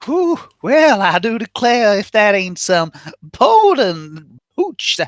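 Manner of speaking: southern belle. Acted. Player voicing swamp character Brandy Lee.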